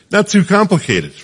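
jumps up high and bright on "Not too complicated", hitting "too"; emphatic exclamation. Real, not acted. Repeats the phrase for emphasis.

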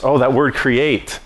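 ordinary talk

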